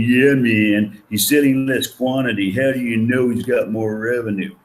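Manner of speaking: imitating a student. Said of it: "students out there saying".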